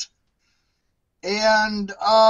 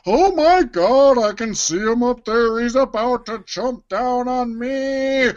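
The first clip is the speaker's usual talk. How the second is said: Henchman twenty-one; 4 semitones higher, 3 dB louder than usual. Acted. Henchman sees wrestler about to jump, in mock terror. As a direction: wail in comic alarm, pitched up. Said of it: "I'd take twenty-one".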